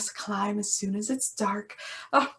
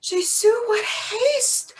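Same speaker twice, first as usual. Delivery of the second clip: much higher and slow, drawn-out protest; hammed-up huff; exasperated exclamation; 9 semitones higher, slower than usual. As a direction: throw up your hands at her impatience. Nurse exasperated at Juliet.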